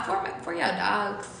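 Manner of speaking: ordinary talk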